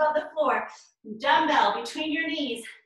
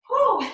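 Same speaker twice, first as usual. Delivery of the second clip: relieved whew; 10 semitones higher, 6 dB louder than usual. Real, not acted. Relief after hard workout.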